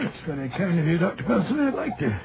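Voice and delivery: a light put-on Scottish accent, rolling the r's. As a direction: play a canny Scot from Aberdeen, lightly. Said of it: "that delightful Scottish accent you sometimes assume".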